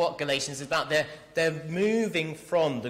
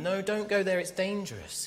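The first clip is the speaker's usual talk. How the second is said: higher-pitched, concerned caution; loving warning; 6 semitones higher than usual. Acted. Paul to believers he loves, urgent warning. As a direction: warn them off because you care. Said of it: "He's warning them".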